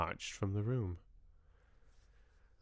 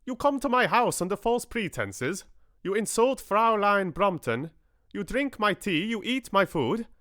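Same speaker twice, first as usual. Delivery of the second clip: put-on high, loud, outraged corny German accent; a German host accusing his guest, furious German accent; 10 semitones higher, 4 dB louder than usual. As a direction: rant in a thick hammed-up German accent. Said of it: "such a completely corny accent".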